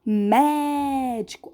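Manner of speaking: MÉDICO with a very long open é; open vowel demo. Shown as a demonstration. Teacher shows pupils another example, drawn-out open MÉDICO. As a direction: stretch the first syllable of médico wide open. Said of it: "eu abro mais a boca".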